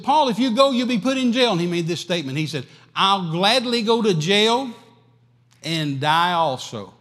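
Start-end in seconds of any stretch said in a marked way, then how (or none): 0.0-1.5 high, loud alarmed warning; friends' alarmed warning. Acted. Friends warn Paul. 2.9-6.9 slow, loud and high, resolute; Paul vowing he will go to jail and die, slow and firm; Paul's bold vow. Acted.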